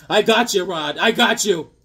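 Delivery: excited, teasing shout-out; playful callout. Pretend. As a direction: holler it to your buddy in triumph. Playful boast called out to friend.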